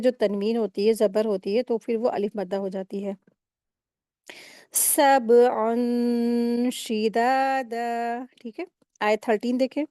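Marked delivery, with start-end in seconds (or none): 4.7-8.3 "सबअन शिदादा" slowly, the noon hidden in the nose and held; ghunna recitation. Shown as a demonstration. Teacher showing students the nasal hiding rule, slow ghunna recitation. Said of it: "नाक में छुपा करके गुन्ना करके पढ़ेंगे".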